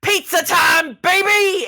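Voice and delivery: high, loud hyped-up holler; rowdy shout. Acted. Man on stage rallying everyone toward Pizza Hut, rowdy shout. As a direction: yell it like a rallying cry.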